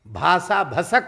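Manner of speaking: loud, sharp scolding of भाषा भसक; furious guru calling up from the well; angry rebuke. Retold or imitated. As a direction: snap भाषा भसक at the foolish student. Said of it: "गुरुजी को बहुत गुस्सा आया".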